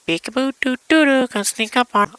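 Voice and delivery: very high, cutesy sneaky voice; singsong it like a game of peekaboo; high-pitched imitation of a playful sneak.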